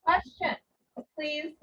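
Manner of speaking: ordinary talk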